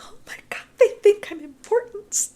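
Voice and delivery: whispered gasp rising into a high breathless squeal; giddy excitement. Retold or imitated. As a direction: retell being starstruck, half whispered. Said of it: "very excited about this".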